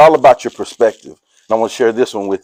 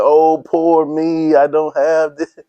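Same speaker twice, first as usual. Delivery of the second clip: mock whiny complaint; 4 semitones higher, slower than usual. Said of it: "I'm not saying".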